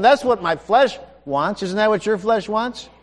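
ordinary talk

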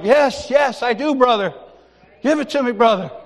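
high, loud, fervent agreement; eager. Retold or imitated. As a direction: act out keen readiness. Himself eagerly answering pastor.

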